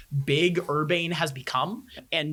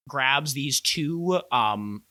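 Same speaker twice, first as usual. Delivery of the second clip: ordinary talk, nothing marked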